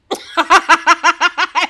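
a loud burst of cackling laughter; loud cackle. Real, not acted. Friend recalling the movie, cackling loudly.

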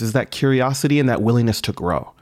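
usual speaking voice

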